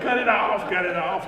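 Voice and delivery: high, loud panicked command; urgent call. Retold or imitated. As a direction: yell to kill the engine now. Retold urgent call to stop engine.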